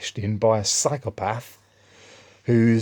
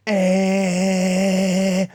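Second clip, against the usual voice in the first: long, high, held car-horn honk; sustained mock beep; imitated car horn; 11 semitones higher, 5 dB louder than usual. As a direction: blare like a horn leaned on non-stop. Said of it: "beeping his horn non-stop".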